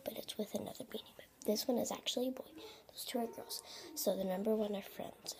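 quick, hushed whisper; whispering to viewers about her toys. Real, not acted. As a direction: whisper fast, close to the mic.

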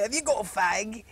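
slow, put-on Cockney accent; Cockney bum. Shown as a demonstration. Actor shows his entrance line, slow Cockney accent. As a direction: beg slowly in stage Cockney. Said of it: "a Cockney bum".

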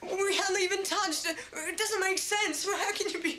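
high-pitched, stunned disbelief; bewildered protest. Acted. Man told of an impossible baby, bewildered protest. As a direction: protest it, voice climbing in alarm.

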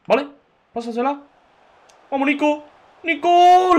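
high, loud shout of the name; excited yell. Real, not acted. Streamer urging his winger forward, loud high yell. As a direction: call him on as he breaks away.

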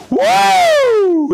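a high, loud sign-off "woo"; excited whoop. Real, not acted. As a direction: end the show with a loud, high cheer. Host's sign-off cheer.

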